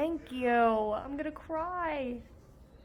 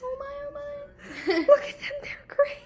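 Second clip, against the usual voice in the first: overwhelmed delight; 13 semitones higher than usual. Real, not acted. Seeing Samantha's socks.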